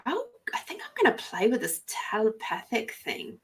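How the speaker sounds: whispering an inner thought to herself; playful whispered musing. Retold or imitated. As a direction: breathe it out like a secret idea. Private thought quoted aloud.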